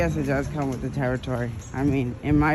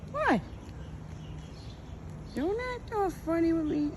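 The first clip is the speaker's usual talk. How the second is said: high sing-song voice for the birds; talking to birds. Real, not acted. Woman cooing at a dove at her feeder, high and playful. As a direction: coo at the dove like a pet.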